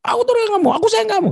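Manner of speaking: mock shouted declaration of love; shouting. Shown as a demonstration. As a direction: bellow the love words absurdly. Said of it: "Nggak ada orang jatuh cinta ngomongnya".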